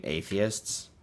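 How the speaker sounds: ordinary talk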